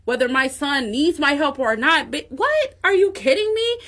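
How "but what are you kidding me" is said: can't believe anyone doubted her; incredulous outburst. Real, not acted. A mother defending her son's success, incredulous and much higher. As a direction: pitch jumps way up, disbelieving. Outraged rant about doubters.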